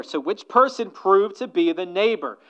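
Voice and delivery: preacher voicing Jesus' pointed question; Jesus pressing the question. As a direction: press the lawyer hard with the question. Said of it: "So Jesus asked the lawyer".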